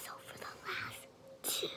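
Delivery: hushed, breathy retake; whispering. Real, not acted. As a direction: back to a soft whisper. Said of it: "I don't know why I'm talking loud".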